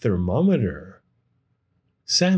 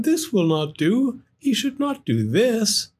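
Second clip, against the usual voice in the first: higher, scolding storyteller voice; gently tut-tutting; disapproving; 10 semitones higher than usual. Acted. Sam disapproving.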